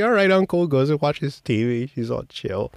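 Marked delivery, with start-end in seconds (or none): 0.0-0.7 a lightly voiced carefree kid; mimicking the niece. Niece answering her uncle, lightly voiced carefree kid. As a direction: toss it off like an unbothered child. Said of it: "She's like".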